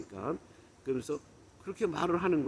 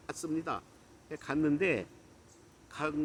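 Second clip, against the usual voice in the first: normal talking voice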